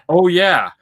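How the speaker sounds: sudden high loud "oh, yeah"; realizing the presents are in the way; surprised realization. Retold or imitated. Retold realization.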